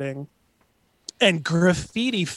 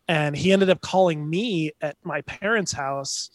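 ordinary talk, nothing marked

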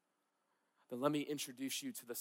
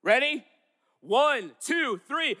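high, loud rallying count-in; emphatic; 11 semitones higher, 12 dB louder, slower than usual. Shown as a demonstration. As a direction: rouse the congregation at full voice. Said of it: "We need to be emphatic".

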